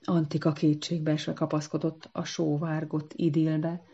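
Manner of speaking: ordinary delivery, nothing marked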